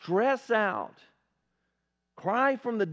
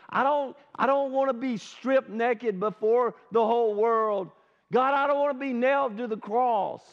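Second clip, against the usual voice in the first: raised, louder anguished plea to God; Jesus pleading in prayer; 6 semitones higher, 4 dB louder than usual. Acted. Jesus begging his Father to spare him, anguished plea. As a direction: cry out like a man dreading what comes. Preacher voices Jesus praying in Gethsemane.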